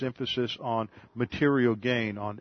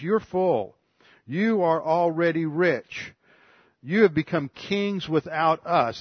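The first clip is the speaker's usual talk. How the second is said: higher, slower, dripping sarcasm; sarcastic; 4 semitones higher, 3 dB louder than usual. Retold or imitated. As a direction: shame them with mock praise. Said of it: "this is where he stops the sarcasm".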